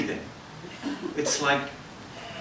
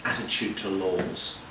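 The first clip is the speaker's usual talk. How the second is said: ordinary talk, nothing marked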